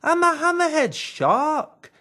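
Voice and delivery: high, loud indignant correction; old shark indignantly corrects Green; correcting old shark. A narrator's reading. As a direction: bristle as you set him straight. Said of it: "corrected the old fish".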